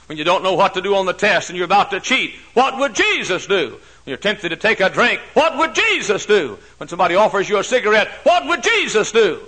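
rising, loud preacher's call; preaching at full force. Acted. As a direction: build it up and ring it out. Preacher building to a shout.

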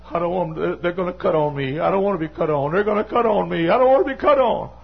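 put-on high, fast, rising pleading of a scared old woman; imitating a frightened mother begging. Mother voiced pleading in story.

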